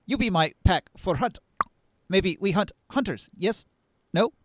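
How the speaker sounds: lightly voiced mantis hunter, broken speech; insect warrior voice. GM voices the Thri-Kreen warrior.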